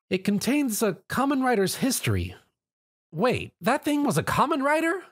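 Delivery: yelped. A narrator's reading. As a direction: catch the shock on Wait. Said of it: "she yelped".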